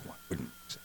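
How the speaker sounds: barely audible whisper; whispered repeat. Retold or imitated. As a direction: let the question fade to a whisper. Last repeat of the quote, whispered.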